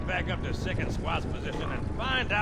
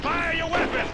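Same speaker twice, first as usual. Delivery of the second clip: higher, louder command under fire; furious sergeant's shout; barked order; 7 semitones higher, 4 dB louder than usual. Acted. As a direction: yell at the frozen soldier to shoot.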